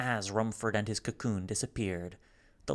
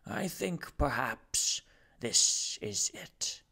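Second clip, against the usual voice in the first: slow, resigned gentleman; a lightly voiced weary aristocrat; calm resigned farewell; Rumford; 2 semitones higher, slower than usual. Rumford speaking from the spiral.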